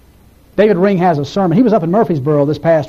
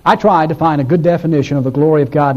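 normal talking voice

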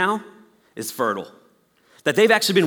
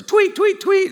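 very high tweeting voice; playful high bird chirps; chirping; 6 dB louder, slower than usual. Acted.

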